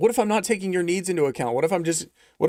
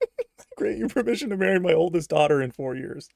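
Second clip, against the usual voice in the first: a lightly put-on voice of a father offering his daughter; mock solemn father; 4 semitones higher than usual. Streamer repeating a viewer's offer in a mock father voice. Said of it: "Kitty Daddy says".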